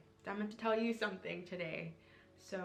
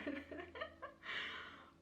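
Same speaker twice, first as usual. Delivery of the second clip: teary laugh; 6 dB quieter than usual. Real, not acted. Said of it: "I'm really getting teary-eyed".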